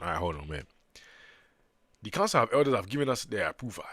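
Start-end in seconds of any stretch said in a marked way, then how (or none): 2.0-3.8 elder's line in a put-on African accent. Voicing the council's approval for the Sumerians, African accent. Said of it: "I keep forgot I made the Sumerians African".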